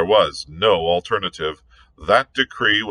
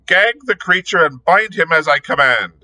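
angry command; 9 semitones higher, 4 dB louder than usual. Acted. Angry chief orders prisoner bound.

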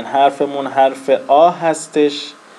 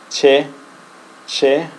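usual speaking voice

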